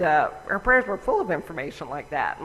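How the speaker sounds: normal talking voice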